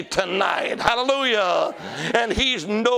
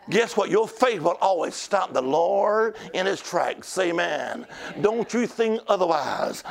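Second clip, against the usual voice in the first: usual speaking voice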